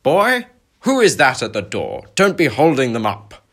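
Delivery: loud, high, barking shout; a gruff uncle booming from another room. Acted. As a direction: bellow at the boy from the next room. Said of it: "by the booming voice of his uncle".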